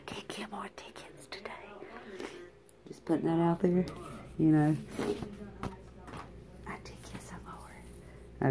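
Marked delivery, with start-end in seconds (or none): 0.1-1.5 hushed fast whisper; sly whispered aside. Real, not acted. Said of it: "I gotta say it slowly, quietly". 6.7-8.5 soft secretive whisper; whispered brag. Real, not acted. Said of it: "I gotta say it slowly, quietly".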